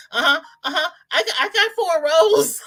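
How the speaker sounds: high, loud giddy mock brag with a laugh; excited bragging. Host imitating a viewer showing off her rows, giddy and high. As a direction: crow about the rows like a proud kid. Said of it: "she's holding it up and she's saying".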